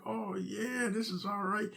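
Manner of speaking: high, soft, smug delight; pleased with himself. Retold or imitated. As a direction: savor the speed quietly. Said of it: "I'm like".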